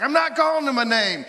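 put-on high, loud indignant boy's yell; yelling back. Boy to grandmother after name-calling, yelling back. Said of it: "the one boy yells back".

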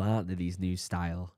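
ordinary talk, nothing marked

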